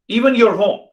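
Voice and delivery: high, loud rhetorical cry; exclaimed peak. Real, not acted. Teacher pressing listeners on loneliness, loud and high. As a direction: throw the question up high.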